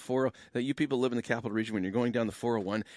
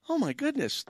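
high, thrilled outburst, said twice; pitched way up in happy disbelief; excited exclamation. Real, not acted. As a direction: gush it with sheer delight. Overjoyed host reacting on air.

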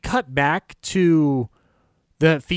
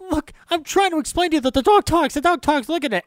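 much higher, fast, exasperated; exasperated Bud; 13 semitones higher, 4 dB louder, faster than usual. Retold or imitated. Bud insisting to Lou that the dog talks, fast and exasperated. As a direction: hammer it home in frustration. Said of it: "he goes like".

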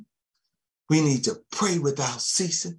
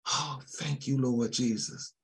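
soft murmured aside to Jesus; murmured prayer; 10 dB quieter than usual. Real, not acted. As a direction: drop to a near-hush prayer under the breath. Murmured prayer aside mid-sermon.

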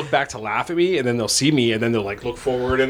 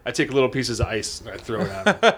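ordinary talk

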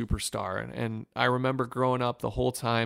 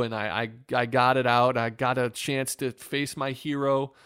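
ordinary talk

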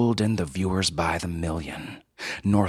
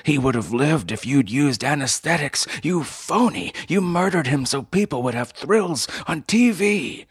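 high, loud, raging accusation; shrill furious accusation; 8 semitones higher, 4 dB louder than usual. A narrator's reading. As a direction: scream the blame at him, high and hard. Son accusing Northrop, shrill.

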